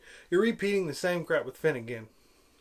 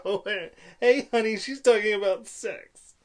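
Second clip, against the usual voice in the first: louder, higher mock delighted aside; hammed-up knowing parent voice; imitated parent; 4 semitones higher, 5 dB louder than usual. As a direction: play a tickled parent nudging a spouse. Imitates parents getting the joke.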